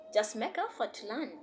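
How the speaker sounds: normal talking voice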